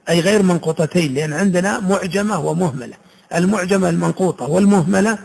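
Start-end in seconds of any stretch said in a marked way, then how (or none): none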